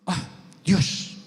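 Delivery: awed whisper. Retold or imitated. Awestruck realisation retold.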